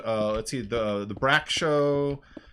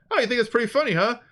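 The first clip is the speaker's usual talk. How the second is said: much higher, louder huffy cartoon hero; hammed-up offended superhero; offended Space Ghost; 13 semitones higher, 5 dB louder, faster than usual. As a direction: storm off in a high indignant voice. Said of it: "And so Space Ghost is like".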